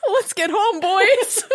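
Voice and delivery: put-on high, rattled boy calling his pals; spooked boys, acted. Tough boy to his pals after the spaghetti brains, mock-spooked. Voices boys fleeing the haunted house.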